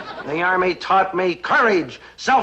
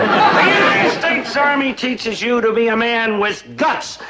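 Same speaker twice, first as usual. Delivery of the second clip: usual speaking voice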